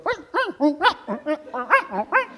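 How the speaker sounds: high, jerky babbling cries; mock outburst. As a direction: splutter in pretend emotional upset.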